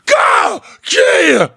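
sudden high yelp of pain; pained yell. Retold or imitated. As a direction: yelp it out as the probe hits the sore ear. Pain when doctor touches ear.

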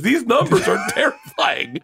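high, slow, squeezed out through laughter; imitating crying laughter. Retelling a failed security ad read, imitated crying laughter. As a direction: act helplessly laughing through the words. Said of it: "we were crying laughing. And he goes".

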